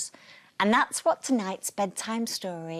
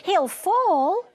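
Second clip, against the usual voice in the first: put-on high, loud alarmed cry of a little girl; sudden high child's alarm; warning; 12 semitones higher, 8 dB louder than usual. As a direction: call out a frightened warning. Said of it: "warned Sally".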